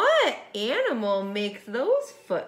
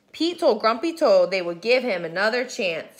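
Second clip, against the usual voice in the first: ordinary delivery, nothing marked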